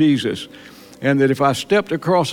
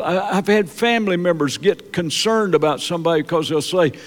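usual speaking voice

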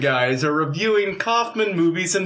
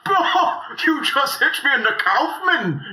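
high pained yelp; 5 semitones higher than usual. Acted. Kaufman brother gets hit.

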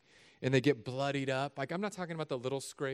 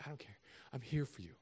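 very soft, tender reassurance; tender comfort; 11 dB quieter, faster than usual. Acted. Parent holding the crying child, soft and tender. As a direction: hush it to a hurt child.

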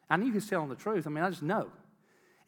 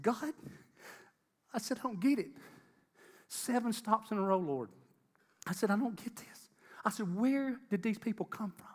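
bewildered; 6 semitones higher than usual. Retold or imitated. Retold bewildered prayer, higher.

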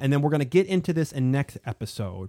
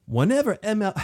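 attempted British accent, higher-pitched; weird accent; 9 semitones higher, 4 dB louder than usual. Host doing the governor's quote, attempted weird British accent. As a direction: put on a hammed-up, odd British accent. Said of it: "try to read this in a weird accent".

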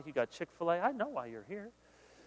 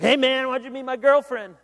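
loud, higher mock protest; friend's protest; 9 semitones higher, 8 dB louder than usual. Friend confronting you about his girlfriend, loud high protest. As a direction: bark it like an offended buddy. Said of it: "your friend's like".

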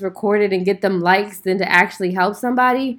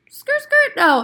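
squealing mock tire-screech skirt skirt; slower than usual. Dismissing the killers who beg for mercy with a high mock screech. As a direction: screech the brakes high then a flat no.

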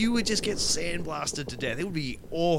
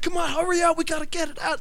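high-pitched, urgent mimicked yell; mock yelling; 11 semitones higher than usual. Film fan mimics the hothead ordering everyone around, mock yelling. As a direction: imitate someone hurrying everybody along. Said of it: "he's constantly yelling at everyone else".

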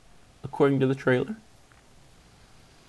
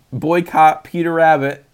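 normal talking voice

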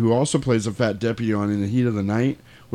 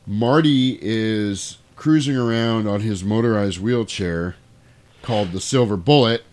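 ordinary talk

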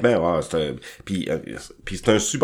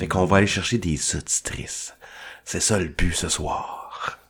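low, slow sultry murmur; sensual voice; 3 semitones lower, 4 dB quieter than usual. Acted. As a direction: seductive and unhurried. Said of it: "Je vais prendre ma voix".

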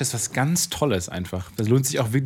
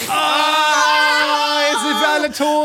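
high loud wailing cry; dramatic wail; 6 dB louder, much slower than usual. Acted. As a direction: wail at the final disaster. Chandelier falls, dramatic end.

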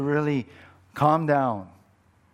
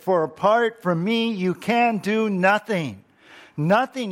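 louder and higher, hammered home; forceful preaching; 6 semitones higher, 4 dB louder than usual. Acted. Preacher pressing scripture on the congregation, loud. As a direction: drive the verse home.

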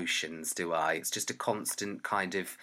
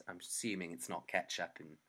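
usual speaking voice

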